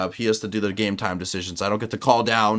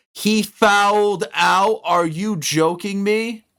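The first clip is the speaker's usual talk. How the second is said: high, loud and slowed, each word stretched; can't believe what he's seeing; drawn-out incredulous wail; outraged disbelief; 10 semitones higher, 5 dB louder, much slower than usual. Real, not acted. Best shooter fouled out.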